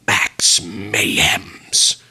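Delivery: dramatic whisper. Acted. Dramatic title announcement, whispered.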